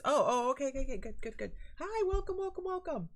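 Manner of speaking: high, loud, delighted greeting; real excited hellos, voice up high; excited welcome. Real, not acted. Greeting a friend joining live.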